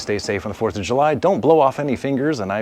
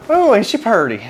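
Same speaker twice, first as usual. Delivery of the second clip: put-on a proud country boy admiring; hillbilly voice; 12 semitones higher, 6 dB louder than usual. Admiring his flag.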